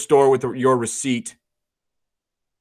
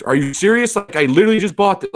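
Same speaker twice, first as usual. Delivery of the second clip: higher-pitched mock indignation; acted customer outrage, pitched up; making a fuss; 6 semitones higher, 3 dB louder than usual. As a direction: play an incredulous customer kicking up a fuss. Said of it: "they make the fuss".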